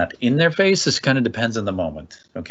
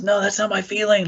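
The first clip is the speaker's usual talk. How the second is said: put-on much higher, childlike protest; child objecting; 11 semitones higher than usual. Child correcting the parent's guess, in a high childlike voice. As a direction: play a kid insisting you got it wrong. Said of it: "if the child says".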